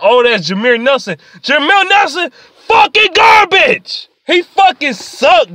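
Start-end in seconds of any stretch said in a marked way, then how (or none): 1.4-5.5 high, drawn-out angry shout; real outraged rant, voice pitched up; exasperated. Real, not acted. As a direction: yell your disgust at a useless player. Angry rant about a bad player.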